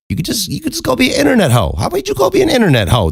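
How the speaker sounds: fast, high-pitched pitch; eager suggesting. Retold or imitated. Teller re-enacts urging her online, fast and eager. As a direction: sell the idea quickly in a high voice.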